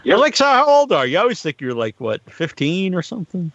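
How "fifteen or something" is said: ordinary talk, nothing marked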